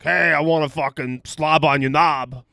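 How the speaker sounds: mock a crass Tinder match in a put-on accent; crude Tinder guy. Host mocks crude Tinder messages in a hearty put-on accent. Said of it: "that was a pretty hearty accent".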